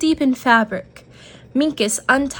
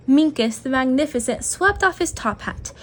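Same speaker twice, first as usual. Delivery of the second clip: ordinary delivery, nothing marked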